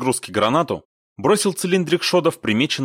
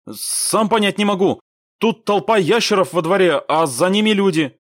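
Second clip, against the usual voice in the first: high, tense, raised voice; urgent radio report; 10 semitones higher, 5 dB louder than usual. A narrator's reading. Squad leader radioing about people behind enemy, urgently. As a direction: report something baffling from the battlefield.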